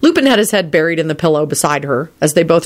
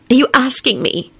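high, puzzled woman's voice; bewildered; 9 semitones higher than usual. A narrator's reading. Elizabeth answering Lupin's question, bewildered. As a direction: ask in pitched-up disbelief. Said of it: "she said in a bewildered tone".

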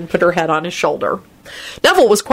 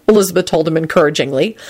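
ordinary delivery

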